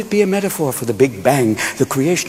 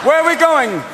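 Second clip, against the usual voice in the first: high-pitched hollered question; calling question; 13 semitones higher, 3 dB louder than usual. Acted. Follower asks the leader the route, high call. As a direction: call it out from the crowd.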